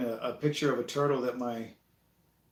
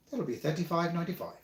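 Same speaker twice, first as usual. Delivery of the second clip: Geico gecko voice; 4 semitones higher than usual. Retold or imitated. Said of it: "made him sound like the Geico gecko".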